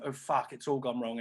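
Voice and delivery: usual speaking voice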